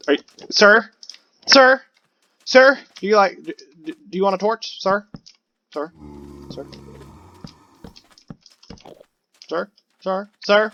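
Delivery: loud, high calls of sir; hollering sir at a villager; calling out. Pretend. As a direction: call to the villager for attention.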